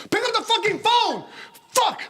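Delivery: high, yelling at the phone; furious shout. Acted. Frustrated man yelling at his phone in the night, furious shout. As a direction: lose it and scream at the unanswered call.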